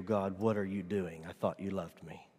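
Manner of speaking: low, soft lament to God; quiet, understated reproach; subdued complaint. Acted. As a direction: murmur a hurt question upward. Voices foolish complaint to God, subdued.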